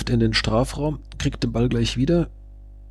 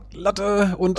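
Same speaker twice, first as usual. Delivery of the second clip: high, drawn-out live reaction; stretched-out, high-pitched exclamation; startled excitement; 8 semitones higher, 3 dB quieter, slower than usual. Real, not acted. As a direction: voice jumps up as the ball hits the bar. Ball hits the bar, live.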